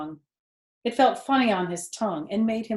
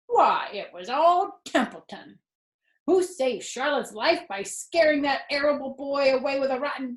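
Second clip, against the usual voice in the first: high, nasal-free sneering rat voice, grumbling; boastful rat; 7 semitones higher than usual. Acted. Templeton listing his favours to Wilbur, boastful and fed up. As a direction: brag and grumble like a put-upon rat.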